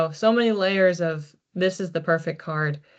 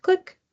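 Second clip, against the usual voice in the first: mock click; 13 semitones higher than usual. Mimics a flashlight switching on.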